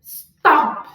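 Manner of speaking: "Stop" punched loud on the O; stress on the O. Shown as a demonstration. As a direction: make the O pop in "Stop". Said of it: "Estala lá no O".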